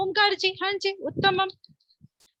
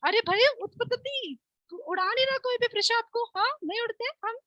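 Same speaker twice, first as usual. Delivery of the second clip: high, amused mock disbelief; teasing surprise; 7 semitones higher than usual. Real, not acted. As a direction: tease the pupil who won't fly. Teacher teases pupil Prisha. (ऊँची आवाज़ में चिढ़ाता आश्चर्य)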